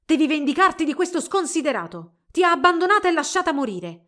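high, loud furious demand; infuriated. A narrator's reading. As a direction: shout it, urging revenge. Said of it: "Mirta lanciò un urlo infuriato".

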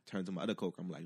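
ordinary delivery

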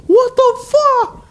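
very high, drawn-out mock scream; re-enacted shriek of outrage; outraged yell. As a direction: burst out high and loud in disbelief. Re-enacts outburst in quiet laundromat.

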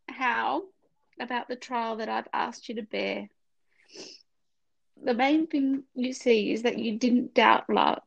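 crying through a devotional reading to a friend; tearful. Real, not acted. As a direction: voice breaking into tears. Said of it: "Oh, I'm gonna cry again".

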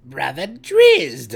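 high, loud, slow call of a priestess. A narrator's reading. Brisa calls loudly to her brother Drizzt, high priestess voice. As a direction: call out so the patrol hears. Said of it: "Brisa said loudly".

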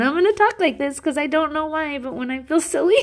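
high, playful silly voice. Pretend. Host showing listeners how she talks when silly, high goofy voice. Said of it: "that's how I talk".

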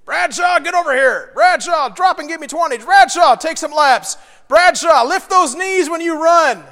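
loud, high barked orders; barking gym coach. Retold or imitated. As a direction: bark orders like a drill coach. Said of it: "I was recognized by the coach".

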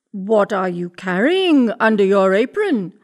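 loud wolf voice, altered timbre; prying storybook wolf; wolf questioning the girl. A narrator's reading. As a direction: ask it loudly as the prying wolf.

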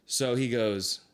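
normal talking voice

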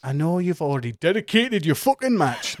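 mock Geordie. GM repeats the line in a mock Geordie accent. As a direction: lay the Geordie on thick. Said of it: "Gone a bit Geordie then".

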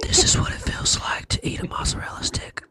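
breathy ad whisper. Pretend. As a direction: whisper it like a snack ad. Parodies a whispered gum ad.

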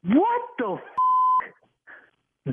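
high, drawn-out what the; stunned disbelief. Real, not acted. Husband hearing the diabetes claim, stunned. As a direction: let it stretch in total shock.

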